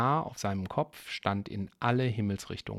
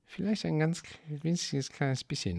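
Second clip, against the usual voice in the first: mumbled; 4 semitones higher than usual. A narrator's reading. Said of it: "nuschelte Bro".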